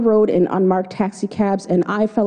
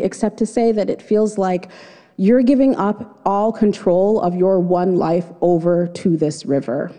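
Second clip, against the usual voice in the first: usual speaking voice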